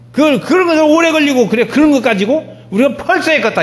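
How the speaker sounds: high, loud re-enacted telling-off; impatient scolding. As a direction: scold assistants for taking too long. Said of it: "부목사님들도 막 혼내고 그럽니다".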